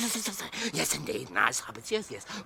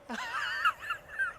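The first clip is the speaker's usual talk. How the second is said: creepy creature cackle. Acted. Gollum laughs to himself.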